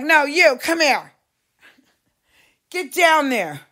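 loud, high commanding call; bossy loud order. Retold or imitated. Mimicking staff ordering extras, loud. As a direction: order them down like crew. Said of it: "And they're like".

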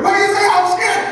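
high, loud frightened yelp at God; panicked high outcry. Retold or imitated. A preacher yelling his panic back at God. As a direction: cry out like a terrified child. His scared reply repeated.

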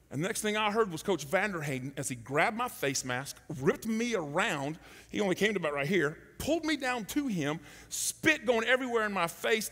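ordinary talk, nothing marked

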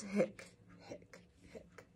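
breathy little hiccups; tiny voiceless hiccups; whispered hiccups. Acted. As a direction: hiccup under your breath.

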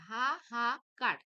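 হা, হা, কার as three separate loud syllables. Shown as a demonstration. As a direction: break the word into হা, হা, কার. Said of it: "এখানে দেখো দুবার". (হা, হা, কার আলাদা দলে ভেঙে বলা)

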